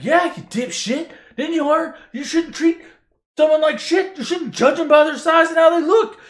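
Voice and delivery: high, loud scolding rant; mock tirade. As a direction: tear into him in a shouted, comic dressing-down. Obi-Wan voiced berating Luke.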